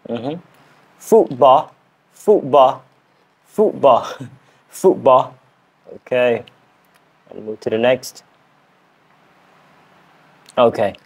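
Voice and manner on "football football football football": learner's British "football", carefully repeated; British accent attempt. Shown as a demonstration. Learner repeating a challenge word, British accent attempt. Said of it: "I am learning British accent".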